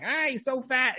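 high-pitched mock fuss over a baby; mock gushing. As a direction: imitate a gushing first look at a newborn. Mock first words to newborn.

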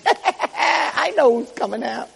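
high, slow, sing-out taunt; drawn-out high villain voice; gloating Satan. Retold or imitated. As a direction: gloat like a devil who knows a secret. Satan gloats after a cackle.

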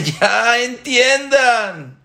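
high, drawn-out impassioned cry; stretched, high-pitched pleading shout; roaring warning. Acted. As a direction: roar a slow warning at your children. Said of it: "Boreolam está rugiendo".

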